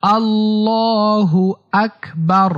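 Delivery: আল্লাহু আকবার slow, with a long held "llaa"; আল্লাহু আকবার in measured, elongated recitation; correct takbir pronunciation. Shown as a demonstration. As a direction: stretch the middle vowel of আল্লাহু, then say আকবার distinctly. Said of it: "সঠিক উচ্চারণ হচ্ছে".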